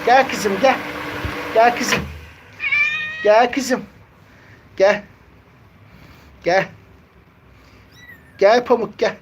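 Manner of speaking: high coaxing call to a cat. Real, not acted. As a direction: coax her over in a high voice. Kediyi yanına çağırıyor. (kediyi tiz sesle çağırma)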